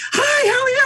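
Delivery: very high, squealing mock hello; squeaky fake greeting. Host mimics trainer greeting a dog face to face, squealing high. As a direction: squeal the greeting like an overeager stranger. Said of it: "And she goes".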